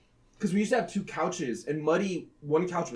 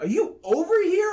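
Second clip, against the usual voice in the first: higher, raised call; calling into the dark; 10 semitones higher, 4 dB louder than usual. Retold or imitated. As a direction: ask it searching in the dark. Searching dark couch for cat.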